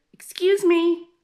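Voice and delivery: the giraffe politely getting attention; giraffe calling. A narrator's reading. Giraffe calling Ellie again.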